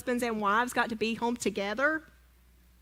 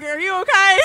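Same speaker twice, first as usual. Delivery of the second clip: higher and louder, laughing and hammed-up; playful mock concern; 8 semitones higher, 11 dB louder than usual. To her husband lying on the couch, higher, louder and mock worried. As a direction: fuss over him with mock worry.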